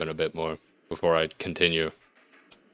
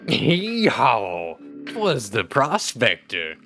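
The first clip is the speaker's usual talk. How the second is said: high, whooping prospector holler; old-timey prospector; 4 semitones higher, much slower than usual. Acted. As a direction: hoot it like a hammy gold prospector. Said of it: "He's doing the prospector again".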